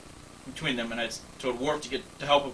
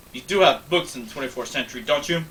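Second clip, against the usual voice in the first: plain reading voice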